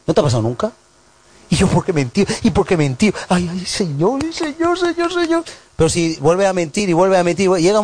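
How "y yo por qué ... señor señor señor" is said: mock anguished liar. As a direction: pitch climbing to a high wail on Señor. Imita al que mintió, angustiado. (lamento exagerado que sube muy agudo)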